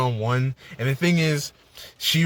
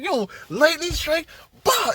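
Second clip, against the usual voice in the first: hyped cheer; 13 semitones higher than usual. Retold or imitated. Hyped fan cheering Cap's attack.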